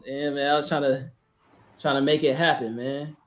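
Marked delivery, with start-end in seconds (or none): none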